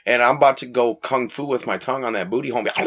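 ordinary delivery

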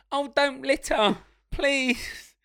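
slow, high, prim hero plea. Retold or imitated.

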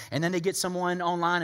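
ordinary talk, nothing marked

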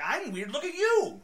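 loud, high mock-indignant comeback; hammed-up squabbling, voice shooting up; acted retort. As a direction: throw the accusation back, rising to a yell. Acts a couple's quarrel.

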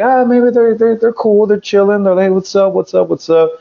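mimicking the guys as totally chill; mock hopeful. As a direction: talk yourself into it in a lifted carefree voice.

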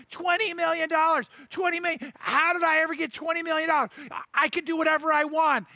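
overjoyed shouting about the money; excited. Retold or imitated. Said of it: "her husband's sitting there going".

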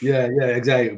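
normal talking voice

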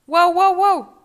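high loud barking; dog barking. Acted. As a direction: bark like an angry dog. (ladridos fuertes)

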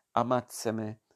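usual speaking voice

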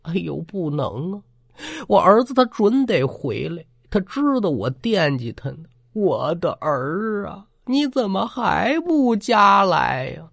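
put-on old mother pleading for her son to come home, grieving. A narrator's reading. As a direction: mourn and call for her son. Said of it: "一脸哀伤".

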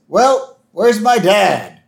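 put-on high little-girl demand; Lisa. Host as Lisa asking after her dad, high and insistent. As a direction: pipe up high, stretching the question.

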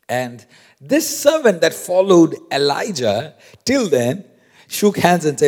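ordinary talk, nothing marked

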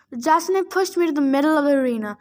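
ordinary delivery

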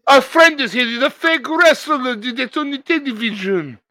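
very high, silly French voice; mock French accent. Mock Frenchman explaining the wrestler guest, high French accent. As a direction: squeak it out with a hammed-up French accent.

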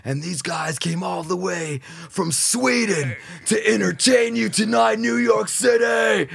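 slow, hammed-up stage announcer with music; mock concert announcer call-out; announcer intro. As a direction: sell the band to the crowd, drawn out.

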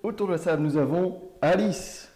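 show host introduction. Acted. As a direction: present the guest like a TV host. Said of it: "host presents guest like a show".

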